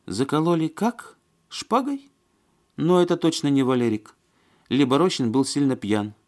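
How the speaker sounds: very high, incredulous woman's voice; Маня. A narrator's reading. Woman hearing of the sword killing, incredulous. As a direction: squeak the questions in disbelief.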